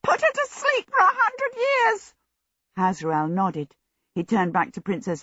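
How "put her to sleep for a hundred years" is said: very high piercing demand; small djinn at the end of his patience; shrill it out like a trumpet. A narrator's reading. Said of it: "he shrilled".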